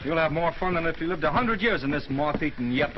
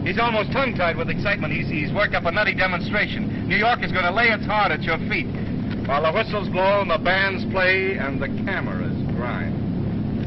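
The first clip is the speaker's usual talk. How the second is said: excited; 5 semitones higher, 4 dB louder than usual. Acted. Hyping the welcome parade.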